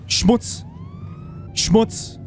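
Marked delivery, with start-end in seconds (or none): 1.5-2.2 breathed out. Acted. Repeats the insult, now breathed. (Schmutz tonlos gehaucht)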